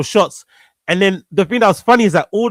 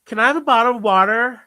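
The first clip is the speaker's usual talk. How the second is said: high-pitched put-on American woman; Brit's high, girly American impression; feminine American accent; 7 semitones higher than usual. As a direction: turn into an American girl, rising question. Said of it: "whenever I do an American accent, I, I, I turn into a woman".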